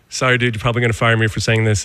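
hurried apology; said really quick. Retold or imitated. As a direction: get it out quickly in one rush. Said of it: "I said really quick".

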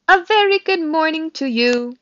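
high, loud robot greeting; over-friendly robot calling out; booming friendly voice. A narrator's reading. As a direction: boom it cheerily across a pond. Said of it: "friendly voice boomed over the water".

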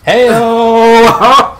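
shouted high heyo; loud. Acted. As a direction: belt it like a rimshot. Punchline call after joke.

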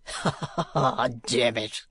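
affected, slow ha-ha laugh; dandy's put-on chuckle, drawn out; laughing fop. A narrator's reading. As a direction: laugh foppishly into the words. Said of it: "cried the gentleman".

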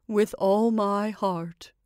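slow, subdued puppet voice; obedient Scarecrow. Acted. Scarecrow agrees to heed Mother, slow and obedient. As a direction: answer slowly and dutifully.

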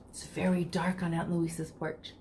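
usual speaking voice

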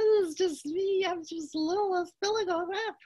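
tiny, high, timid goat voice; littlest goat. Acted. Littlest goat answering the bridge voice, tiny and high. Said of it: "littlest Billy Goat Gruff said".

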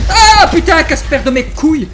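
very high, loud startled cursing shout; shriek, then swear at the ghost; scared shout. Real, not acted. Gamer yelling at a sheet ghost, startled.

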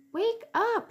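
high mother mouse voice; a bright mouse mother calling. Acted. Mother mouse waking Ralph.

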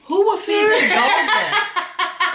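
high, slow laughing outrage; disgusted laugh. Real, not acted. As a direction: stretch it out cracking up.

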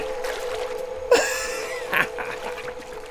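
breathless wheezing laugh; silent laugh. Real, not acted. Player wheezes with silent laughter after dying.

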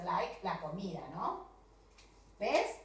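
normal talking voice

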